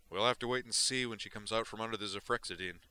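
big, wired, careless delivery; Duke's voice. Acted. Duke brushes off Zoner's question, loud and wired.

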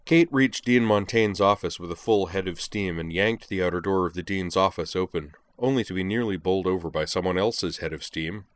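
ordinary delivery, nothing marked

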